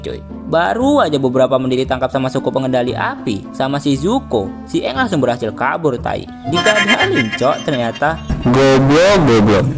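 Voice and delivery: ordinary talk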